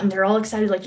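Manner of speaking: ordinary talk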